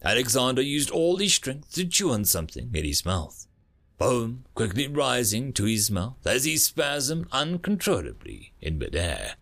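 usual speaking voice

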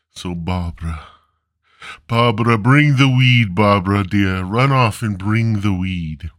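ordinary delivery

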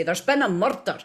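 Scottish TV detective line; rolled R on "murder", Scottish style; Scottish accent. Shown as a demonstration. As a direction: announce it in broad Scottish. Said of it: "So we would say".

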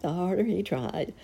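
usual speaking voice

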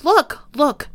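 high-pitched, excited calling; shouted excitedly. A narrator's reading. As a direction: urge them to look, pitched high. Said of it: "he shouted excitedly".

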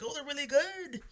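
high put-on kid voice; child. Child praising the cook's ribs, high imitation.

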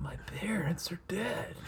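hushed mock-dramatic whisper. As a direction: breathe it like a secret. Quoting Home Alone line.

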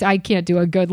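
usual speaking voice

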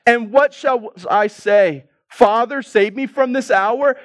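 high, loud sarcastic question. Acted. Jesus asking whether to plead for rescue, sarcastic. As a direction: ask it as a pointed mock plea to the Father. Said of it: "Do you hear the sarcasm?".

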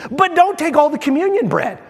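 ordinary delivery

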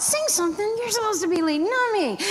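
high, incredulous inner protest; shocked disbelief. Retold or imitated.

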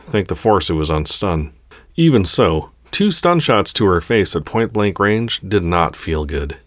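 ordinary delivery

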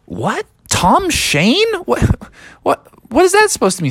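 high, incredulous questioning; baffled disbelief. Acted. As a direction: splutter it in confusion, ending fast. Dad baffled by the name.

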